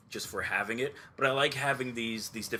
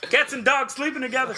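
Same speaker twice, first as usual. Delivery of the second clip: high, loud hammed-up panic; mock doom; 12 semitones higher, 5 dB louder than usual. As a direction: wail it like the world's ending. Mock apocalyptic outrage.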